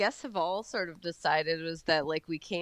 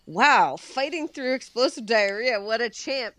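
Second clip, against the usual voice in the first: impressed hero, louder "wow", lifted pitch; Superman; 5 semitones higher, 4 dB louder than usual. Retold or imitated. Fan quoting a favorite movie line, mock-impressed hero voice. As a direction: cheer it on as a mock-admiring hero.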